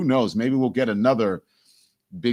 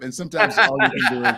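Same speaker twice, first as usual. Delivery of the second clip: laughing; 11 semitones higher, 4 dB louder, faster than usual. Real, not acted. Laughing about the sunk boat.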